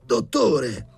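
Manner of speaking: high, loud panicked cry; alarmed high-pitched yell; frightened shriek. A narrator's reading. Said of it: "strillò Sharikov impallidendo".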